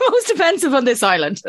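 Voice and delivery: high-pitched, through laughter; laughing. Real, not acted. Telling an American which label offends most, laughing. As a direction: crack up as you repeat it. Laughs while repeating own verdict.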